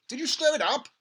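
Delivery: an impatient dad, loud and keen; eager father. Acted. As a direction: press him for the recipe. Father voiced in story.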